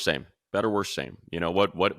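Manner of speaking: usual speaking voice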